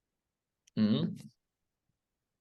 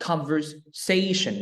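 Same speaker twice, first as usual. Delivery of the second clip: "conversation" slowed, weight on SA before the ending; stress before -tion; 3 semitones higher, much slower than usual. Shown as a demonstration. Teacher gives learner an example of the tip, stress before -tion. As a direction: stretch and hit SA in "conversation". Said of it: "thì nhấn vào cái âm trước đó".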